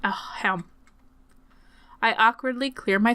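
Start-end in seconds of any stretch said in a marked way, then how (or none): 0.0-0.6 muttered aside. Acted.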